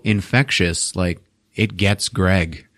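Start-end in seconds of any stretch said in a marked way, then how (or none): none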